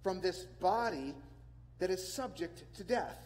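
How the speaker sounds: slow, deliberate reading of Paul's question; slow plea. Acted. Preacher reading Paul's cry for rescue to the church, slow and solemn. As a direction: draw out the question slowly. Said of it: "He says".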